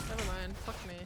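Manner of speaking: normal talking voice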